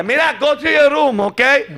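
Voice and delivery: lightly put-on Spanish accent. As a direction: Latin parent with a slight Spanish accent. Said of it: "can you say in the Spanish accent".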